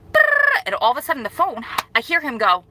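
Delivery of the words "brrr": shrill burst of "brrr" way up and loud; a fast high ranting noise to mimic going off; mock babble standing for her rant. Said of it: "I was like".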